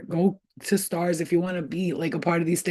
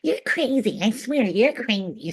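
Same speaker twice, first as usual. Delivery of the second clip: put-on high, slow teen taunt; daughter's mocking voice; 7 semitones higher, slower than usual. Daughter calling mom crazy, mock teen whine. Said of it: "she'll be like".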